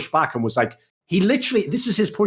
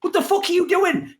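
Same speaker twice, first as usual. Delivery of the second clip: much higher, exasperated mock yell at a player; mock outraged yell; slower than usual. Turning to the player Upset, hammed-up much higher outraged yell. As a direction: throw up your hands and shout it, hammed up. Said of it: "I just turn to Upset and go".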